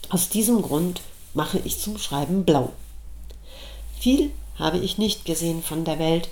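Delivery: ordinary delivery, nothing marked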